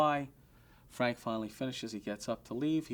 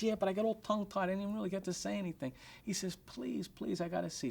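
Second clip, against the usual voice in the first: pleading; 8 semitones higher than usual. Retold or imitated. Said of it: "like a little lamb".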